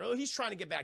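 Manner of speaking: ordinary delivery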